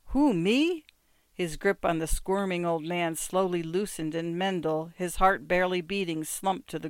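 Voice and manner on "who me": astounded. A narrator's reading. Ginsberg looked astounded.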